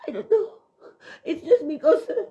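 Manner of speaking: sobbing laugh, overcome with joy; real tears catching in the throat; crying. Real, not acted. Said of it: "I want to cry".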